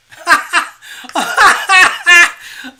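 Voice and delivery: loud high helpless laugh; laughing fit at her own joke; burst of laughter. Real, not acted. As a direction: crack up out loud. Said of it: "I don't know why I'm laughing".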